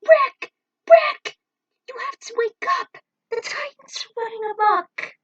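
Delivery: frantic high shrieks of his name; panicked girl screaming to wake her unconscious friend; desperate screaming. Acted. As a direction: scream at him to wake, terrified. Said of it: "shaking and begging him".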